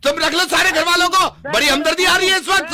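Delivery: very high, loud angry yell; shouting. Acted. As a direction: shout him down. Phone पर चीखता हुआ झगड़ा. (गुस्से में ज़ोर से चीखते हुए)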